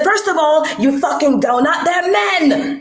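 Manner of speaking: high-pitched mock cussing-out, hammed up; Envy's retort. Quoting Envy cursing her out, high mock scolding. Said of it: "she said".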